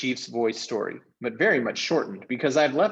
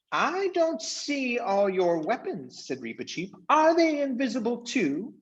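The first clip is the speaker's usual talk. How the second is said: high, deliberate, proud challenge; a small gallant knight calmly calling a bluff; mouse knight; 12 semitones higher, 4 dB louder, slower than usual. A narrator's reading. Reepicheep challenges the voices.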